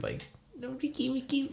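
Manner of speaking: mock weird call. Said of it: "like, a weird".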